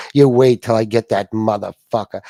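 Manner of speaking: loud, low-pitched mock threat; growing anger of a rival, played up; acted threat. Rival vows revenge at night, mock threat.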